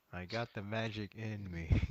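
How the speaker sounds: flat, lifeless monotone. Retold or imitated. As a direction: say it with no expression. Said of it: "very monotone".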